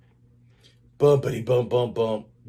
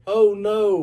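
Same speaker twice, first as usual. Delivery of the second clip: dismayed; 9 semitones higher, 7 dB louder than usual. A narrator's reading. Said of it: "the children said".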